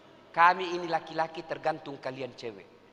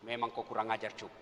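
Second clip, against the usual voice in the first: low angry husband; 8 semitones lower, 6 dB quieter than usual. Acted. Angry husband scolding Yusuf.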